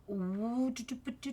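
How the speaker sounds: usual speaking voice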